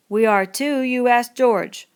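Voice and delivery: loud, slow "You ask George"; stubborn shouting insistence. A narrator's reading. Lenny insisting to Crooks, loud stubborn.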